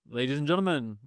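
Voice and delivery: slow, high, booming PA call; arena announcer. Shown as a demonstration. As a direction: announce it like a basketball PA man. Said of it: "try this out".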